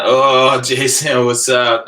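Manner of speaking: calls out a friend's name, high and loud; laughing hello to a buddy who just showed up; delighted shout-out to a friend; excited greeting. Real, not acted. Greeting a friend joining the stream.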